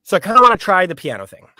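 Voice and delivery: fast, loud eager ask. Retold or imitated. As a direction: blurt it out excitedly. Excited call to friend.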